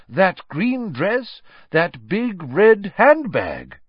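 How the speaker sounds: high, loud, slow relish; emphatic, animated teacher; Professor Lupin rousing the class. Acted. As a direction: build item by item to a comic climax.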